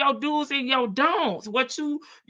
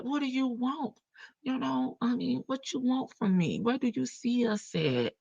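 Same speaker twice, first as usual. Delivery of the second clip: soft, hushed seductive murmur; bedroom voice; 8 dB quieter than usual. Retold or imitated. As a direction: murmur softly to a lover. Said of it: "Put on his bedroom voice".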